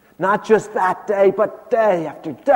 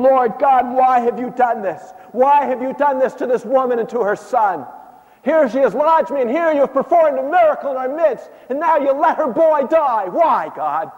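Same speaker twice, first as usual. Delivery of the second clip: angry prayer; 7 semitones higher than usual. Acted. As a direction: demand answers from heaven, hurt and furious. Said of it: "I was angry to the point of saying".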